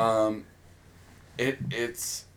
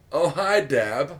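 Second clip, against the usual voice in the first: lightly put-on film-quote greeting, higher; quoted catchphrase; 4 semitones higher, 5 dB louder, slower than usual. Host introduces co-host with a film catchphrase, mock greeting. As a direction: toss out a mock movie greeting. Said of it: "the only show that says".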